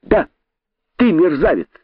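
high, gleeful taunt; joyful cry. A narrator's reading. Impudent rabbit confirming the insult to python, gleeful high cry. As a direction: fire it back with delight. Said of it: "радостно закричал дерзкий кролик".

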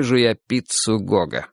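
usual speaking voice

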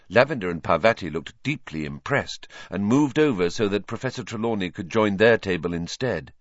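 plain reading voice

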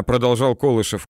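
ordinary delivery

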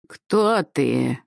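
harsh cawing crone's demand; crow-like croak. Acted. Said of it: "голос стал похож на воронье карканье".

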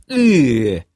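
drawn-out sound of distaste; a touch of disgust. A narrator's reading. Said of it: "скривился я".

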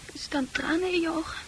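soft whisper. Acted. Fran whispering to Arn, softly. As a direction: hush it in wonder.

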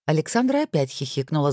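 ordinary delivery

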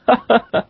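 high burst of laughter; laughing at own joke. Real, not acted. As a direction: laugh at your own pun. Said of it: "quand on rit à sa propre blague".

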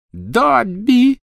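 high-pitched exclamation of shock; startled cry. A narrator's reading. Witch aiming at the door sees the elf, crying out. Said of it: "вскрикнула она".

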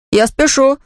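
put-on high-pitched boy's brush-off; grumbled. A narrator's reading. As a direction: blurt it out in a hurry to get away. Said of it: "буркнул Ваня". (буркнув на ходу, высоким голосом)

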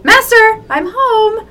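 high, loud cutesy call; sing-song greeting. Acted. Cry comes home and calls out to his master cutely. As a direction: chirp it like a devoted pet. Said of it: "reading the fic aloud".

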